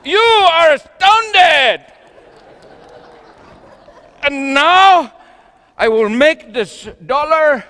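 high, loud and slow proclamation; grand showman shout. Acted. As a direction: announce it like a stage magician. Grand magician announcement.

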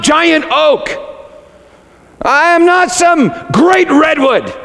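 pleading high cry, building to a straining shout; exasperated Moses. Acted. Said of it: "He, he's saying".

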